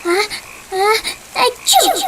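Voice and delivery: building ah ah then high achoo; loud sneeze. Acted. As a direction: wind up and sneeze big. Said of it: "how loud it was".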